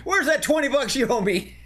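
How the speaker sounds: squeaky high voice; high mock voice. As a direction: pitch it way up. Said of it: "He says".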